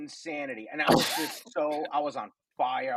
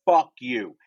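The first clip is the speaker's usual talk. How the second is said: defiant retort; 2 semitones lower, 7 dB louder than usual. Retold or imitated. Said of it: "I said it".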